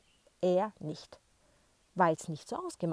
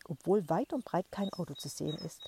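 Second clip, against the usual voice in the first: ordinary delivery, nothing marked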